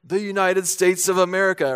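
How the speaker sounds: a hockey player shouting to his coach, only half-volume; mild mock yell. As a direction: call it out proudly to the coach, but toned down. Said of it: "he yells out".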